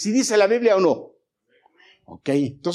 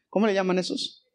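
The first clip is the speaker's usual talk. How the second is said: ordinary delivery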